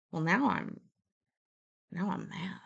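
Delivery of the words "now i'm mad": usual speaking voice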